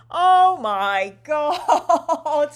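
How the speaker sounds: loud, slow, laughing oh my god; real laughing. Real, not acted. Host breaking into loud, real laughter at the screen. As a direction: crack up through the words.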